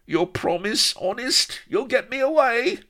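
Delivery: very high, squeaky little figure; cringing Goliath. A narrator's reading. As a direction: plead loudly in a tiny falsetto. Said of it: "licked his lips and cringed at Avery".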